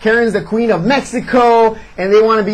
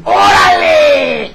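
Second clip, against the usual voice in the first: slow, higher, loud put-on Spanish cry of Orale; mock Spanish shout; 6 semitones higher, 3 dB louder, much slower than usual. Heel's sidekick yelling Orale behind him, mock shout. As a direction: yell it like a hype man. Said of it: "a guy behind you saying".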